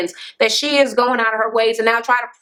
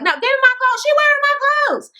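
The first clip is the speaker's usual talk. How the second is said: much higher squealing mock demand for the clothes; mimicking Tanya's whine. Tanya chasing Christy for her clothes, high squealing mock plea.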